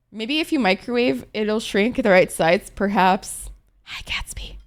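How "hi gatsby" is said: soft unvoiced whisper to the pet. Real, not acted. Streamer whispering hello to her pet Gatsby, hushed whisper. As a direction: breathe the greeting without voice.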